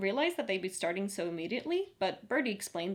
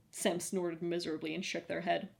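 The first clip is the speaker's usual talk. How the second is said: ordinary delivery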